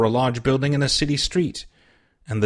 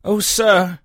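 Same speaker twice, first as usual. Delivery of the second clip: put-on eager boy; 5 semitones higher, 3 dB louder than usual. A narrator's reading. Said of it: "cried I".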